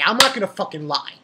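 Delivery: ordinary talk, nothing marked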